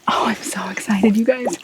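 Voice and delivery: thrilled but whispering; excited whisper. Real, not acted. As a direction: gush in a whisper. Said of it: "so I'm gonna try and talk quietly".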